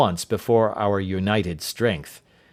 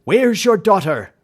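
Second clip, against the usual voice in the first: loud, high, hard demand; angry loud demand; 10 semitones higher, 6 dB louder than usual. Acted. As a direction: demand the answer. Holmes confronts Rucastle.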